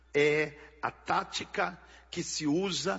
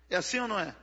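ordinary talk

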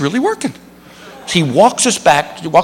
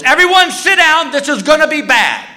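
alarmed flight attendant; 10 semitones higher than usual. Retold or imitated.

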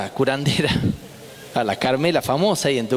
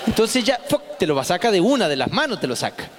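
healer's instructions. Retold or imitated.

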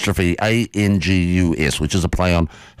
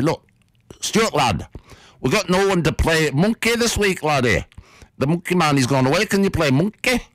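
Northern mate voice; 7 semitones higher than usual. Retold or imitated. Mate asks Stuart to be mascot.

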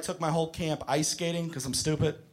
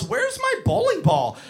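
put-on high-pitched puzzled child; clueless kid; 11 semitones higher than usual. Kid with head in ball return machine, high child voice. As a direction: pipe up like a little kid.

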